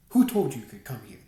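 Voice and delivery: harsh, loud demand. A narrator's reading. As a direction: bark the question at him. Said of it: "he demanded".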